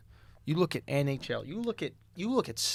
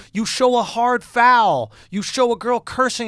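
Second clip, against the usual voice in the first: louder, slower, higher push on foul; raised, slow hype for rough play; emphatic hype; 6 semitones higher, 8 dB louder, slower than usual. Real, not acted. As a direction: sell the hard foul like a pitch. Hyping up rivalries.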